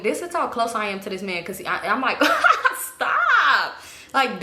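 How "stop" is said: high, squealing laugh into a drawn-out STOP; laughing protest. Retold or imitated. Girl pulled in for kisses by boy, laughing protest. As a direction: giggle helplessly through a half-hearted stop. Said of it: "to where I'm just laughing".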